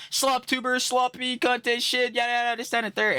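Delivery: high, loud mocking rant; mock hater comment. Streamer imitating commenters trashing his content, high mocking rant. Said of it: "be saying in comments".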